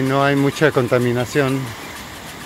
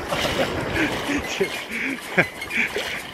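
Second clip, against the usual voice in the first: breathless laugh; 12 semitones higher, 8 dB quieter than usual. Real, not acted. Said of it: "Me dio un golpe en los".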